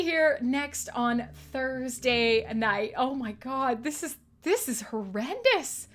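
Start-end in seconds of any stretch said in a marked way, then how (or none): none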